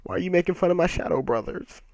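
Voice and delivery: high-pitched put-on plaintive voice; hurt mock whine. Shocked Naruto answers the insult, hurt mock whine. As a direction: whine it high, playing the wounded hero.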